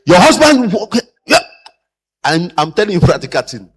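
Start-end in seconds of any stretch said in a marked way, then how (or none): 0.1-1.4 much higher and louder, mock disbelief; incredulous. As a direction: echo it back in disbelief. Mocking a staff reply.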